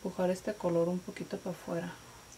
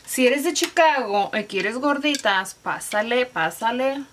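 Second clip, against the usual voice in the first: louder, higher hawking call to Chicago buyers; vendor call; 5 semitones higher, 7 dB louder than usual. Pretend. Vlogger inviting Chicago viewers to buy gorditas, loud vendor call. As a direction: call out to customers like a street seller.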